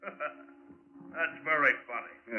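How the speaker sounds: slow, mocking chuckle through the words; scornful laugh. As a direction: laugh it off like it's nothing. Villain scoffs at murder accusation.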